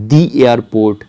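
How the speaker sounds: the airport, slow, "the" as "dee". Shown as a demonstration. Teacher recapping examples for learners, "dee airport". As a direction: stretch "the" to "dee" before airport. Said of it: "এটার ক্ষেত্রে কী ছিল?".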